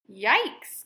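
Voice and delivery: a loud frightened yelp; startled gulp. A narrator's reading. Frog startled by a mooing cat yelps in alarm. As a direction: jump back with a yikes. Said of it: "gulped the frog".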